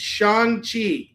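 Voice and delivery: "Shang-Chi" as SHAHNG-chee, high and slow; correct pronunciation. Shown as a demonstration. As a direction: spell out "Shang-Chi" by ear, open "ah". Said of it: "Not like it's written".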